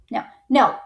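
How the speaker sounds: ordinary talk, nothing marked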